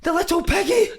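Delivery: put-on very high, incredulous Italian accent; Paolo Di Canio. Italian manager shocked at his player's size, Italian accent. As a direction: gasp it like an appalled Italian coach. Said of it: "he's like".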